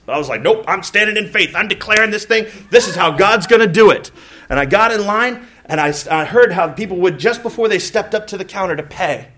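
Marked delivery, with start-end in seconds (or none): 0.4-4.1 louder, higher, acting out stubborn faith; mock-bold self-quote; defiant declaration. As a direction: proclaim it boldly, brooking no argument. Retelling his own bold declaration.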